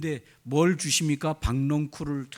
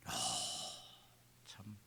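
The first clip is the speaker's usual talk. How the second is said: whispered sigh; 7 semitones lower, 17 dB quieter than usual. Real, not acted.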